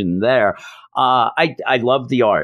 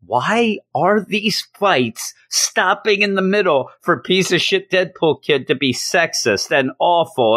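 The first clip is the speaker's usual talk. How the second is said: higher-pitched exasperated complaint; 6 semitones higher than usual. Real, not acted. Reviewer fed up with fights stopping in the middle, exasperated. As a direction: complain in raised-pitch disbelief.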